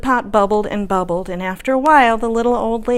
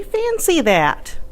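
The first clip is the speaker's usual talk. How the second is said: put-on old lady voice; 8 semitones higher, slower than usual. Old lady's catchphrase voiced.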